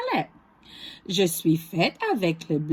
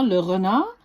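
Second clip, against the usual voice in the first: plain reading voice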